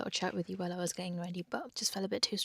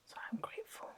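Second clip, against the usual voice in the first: soft early-morning whisper; 12 semitones higher, 8 dB quieter, faster than usual. Real, not acted. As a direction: whisper so the flatmate sleeps on. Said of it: "whisper a little bit".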